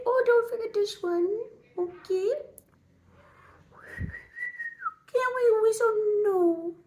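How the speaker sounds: very high, put-on little voice; squeaky cutesy character. Fussing over a little curvy line, cutesy high voice. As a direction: squeal and coo over the makeup in a tiny voice.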